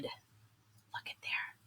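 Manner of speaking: slow unvoiced whisper; hushed whisper. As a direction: whisper it in awe. Hushed wonder landing.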